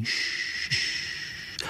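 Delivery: long soft hushing "sh"; soft unvoiced rustle of leaves; rustling sound. A narrator's reading. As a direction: imitate corn rustling with drawn-out sh. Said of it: "ta šumí".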